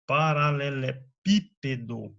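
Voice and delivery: "paralelepípedo" slowly drawn out, weight on PÍ; stress on antepenultimate. Shown as a demonstration. Teacher models a long example, stress on PÍ of "paralelepípedo". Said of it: "sílaba tônica é a antepenúltima".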